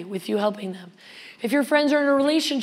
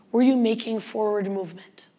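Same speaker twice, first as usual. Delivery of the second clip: ordinary talk, nothing marked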